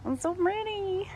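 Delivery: high, delighted gush; squealing in delight at the garden. Real, not acted. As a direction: coo over the view. Gushing over her wide shot.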